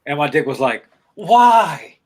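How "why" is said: louder, drawn-out whine of protest; loud protesting echo. Acted. Body part answering the man back, loud mock protest. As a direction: throw the question back loudly.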